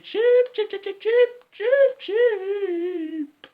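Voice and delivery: squeaky mocking chicken calls; chicken taunt.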